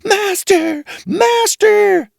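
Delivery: very high shrill magic harp cry; harp calling loud. A narrator's reading. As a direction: shriek for your master. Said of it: "the harp called out quite loud".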